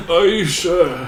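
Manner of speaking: laughing. Real, not acted. Laughing in argument.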